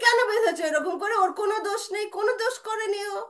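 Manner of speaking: high, heated defence; indignant protest. Acted. Brother defending Krishna to mother, high heated protest. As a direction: argue loudly for the child's innocence.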